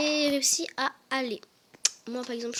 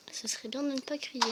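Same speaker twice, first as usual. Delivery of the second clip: ordinary delivery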